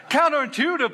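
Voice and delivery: laughing out the word, loud and high; real laugh breaking into speech. Real, not acted. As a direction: let the laugh carry the word. Laughing at friends' phrase.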